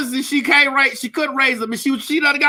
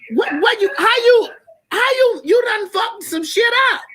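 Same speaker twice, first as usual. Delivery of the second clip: high mock yell; 12 semitones higher than usual. Comic outrage yelling at the man. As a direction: squeal the accusation.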